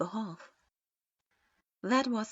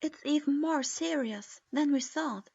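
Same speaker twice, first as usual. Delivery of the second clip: plain reading voice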